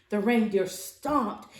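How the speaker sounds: ordinary delivery, nothing marked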